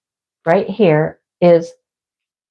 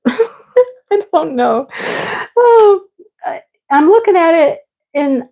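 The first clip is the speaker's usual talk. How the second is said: high, squealing laughter through the words; laughing fit; 10 semitones higher than usual. Real, not acted. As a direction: crack up helplessly mid-sentence. Can't find the chair, cracks up.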